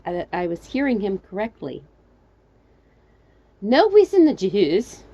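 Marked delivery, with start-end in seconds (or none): 3.6-5.0 loud, slow, raised refusal; calling back. Acted. Trapped narrator contradicting the stranger, calling back. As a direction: shout the answer back up. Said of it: "I called back".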